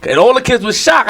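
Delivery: ordinary delivery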